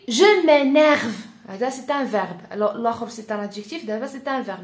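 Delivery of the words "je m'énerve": loud, slow and high mock anger; acted annoyance. Shown as a demonstration. French teacher demonstrating a verb of anger, loudly annoyed. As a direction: hammer out the verb, exasperated.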